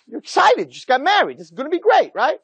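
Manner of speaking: high-pitched newlywed excitement; excited. Acted.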